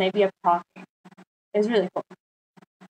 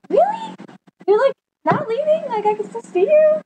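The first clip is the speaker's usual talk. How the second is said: very high, squeaky surprise; high-pitched imitation of sudden relief; mock hopeful disbelief. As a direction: retell it as thrilled, tearful-sounding disbelief. Acts out her sudden relief.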